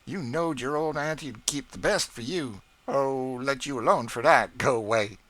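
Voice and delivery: coy, teasing cook; playful teasing. A narrator's reading. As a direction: tease the boy playfully. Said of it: "designed to be immensely facetious".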